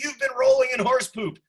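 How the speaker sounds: high, loud scolding of a dog; mock yelling. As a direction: yell it like an exasperated pet owner. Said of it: "I'm yelling at her".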